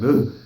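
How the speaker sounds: loud exasperated ugh; big groaned ugh. Retold or imitated. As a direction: groan it out in relief. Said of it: "We're like".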